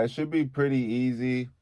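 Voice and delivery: usual speaking voice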